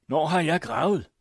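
high, loud indignant protest; angry shout. A narrator's reading. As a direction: hurl the question back angrily. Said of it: "råbte præsten vred".

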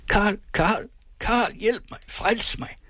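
very high, desperate calling out fading to a breath; heartrending cry for help. Acted. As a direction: scream for Karl in despair. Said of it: "lød det sønderrivende".

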